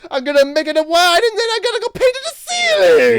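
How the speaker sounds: very high, loud cartoon Italian accent; hammy Italian accent. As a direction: shout it in a goofy Italian accent. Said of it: "I don't know how Italian people talk".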